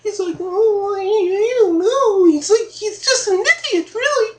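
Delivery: high-pitched character voice; squeaky-high and chatty, pitched far above normal talk; high comic character. Acted. Comic character gossiping about a friend, high voice.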